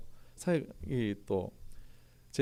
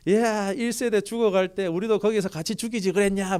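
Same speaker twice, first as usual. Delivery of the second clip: imitating a grumbling crowd, pitched higher; mock complaint; 7 semitones higher, 4 dB louder than usual. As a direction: throw the grievance at Moses like a sulking mob. People grumbling against Moses, voiced.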